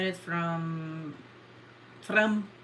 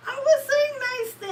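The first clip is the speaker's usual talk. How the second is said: squealed, playful self-defence; high-pitched protest; 3 dB louder than usual. Real, not acted. Caught insulting sister, high squealed protest. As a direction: shoot way up, protesting innocence.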